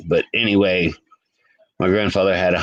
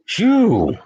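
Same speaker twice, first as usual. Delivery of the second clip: surprised exclaim; 10 semitones higher than usual. Real, not acted. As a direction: blurt it out, startled. Reacting to cold temperature.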